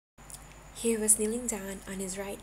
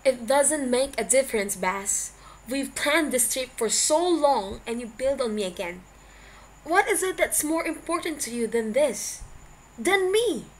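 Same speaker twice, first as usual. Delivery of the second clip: angry yelling; 4 semitones higher, 10 dB louder than usual. Acted. Lovers' quarrel on the phone.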